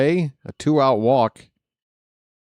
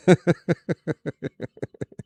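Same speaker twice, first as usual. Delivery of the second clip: higher, rattling run of giggles; amused laugh; 6 semitones higher than usual. Real, not acted. Announcer mocking stupidest name ever, helpless giggle. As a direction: crack up, laugh trailing downward.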